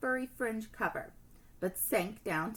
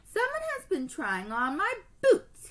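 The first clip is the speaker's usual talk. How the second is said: booming big bear; 10 semitones higher, 4 dB louder than usual. Acted. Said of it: "he boomed in his big bear voice".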